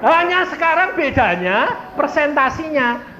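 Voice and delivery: high, loud pulpit delivery; full-force preaching. Real, not acted. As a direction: drive it home to the whole congregation. (khotbah lantang berapi-api)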